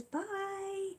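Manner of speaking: high, slow sing-song farewell; sing-song goodbye. Real, not acted. Signing off to the viewers, high sing-song bye.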